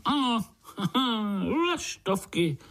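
high, amused yes with a laugh; chuckling confirmation. A narrator's reading. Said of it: "pokýval hlavou strýček". (pobavené přitakání se smíchem)

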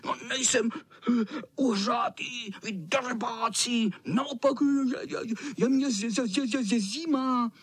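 high, stuttering cold cat voice; shivering tomcat. A narrator's reading. Soaked tomcat complaining to the boys, shivering. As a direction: shiver through the words.

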